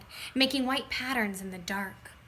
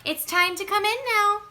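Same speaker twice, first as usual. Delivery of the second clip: lightly voiced parent calling out, higher and louder; 9 semitones higher, 6 dB louder than usual. A narrator's reading. Parent calling the child indoors, raised voice. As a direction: call the kids in from afar. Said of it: "someone called from my house".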